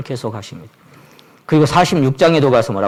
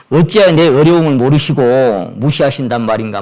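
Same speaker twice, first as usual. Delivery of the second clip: a louder lament, pressed and insistent; lament to God; 6 dB louder than usual. Retold or imitated. Jacob protesting to God, louder and insistent. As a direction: cry out that God ignores you. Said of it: "막 이렇게도".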